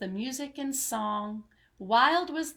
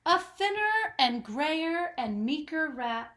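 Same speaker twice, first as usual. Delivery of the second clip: ordinary delivery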